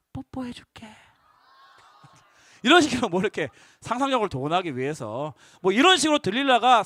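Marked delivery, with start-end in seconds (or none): 0.1-0.9 breathy whisper; whispered promise. Retold or imitated. Delilah whispering a kiss offer to Samson. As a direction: tease him with a whisper.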